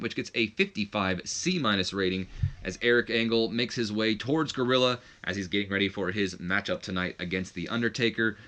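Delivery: ordinary talk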